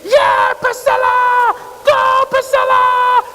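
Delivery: high, loud cheering shout from the stands; imitating an overexcited sports mom shouting her daughter's name; mom screaming. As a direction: yell it like a proud mom at a volleyball game. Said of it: "you could hear her screaming".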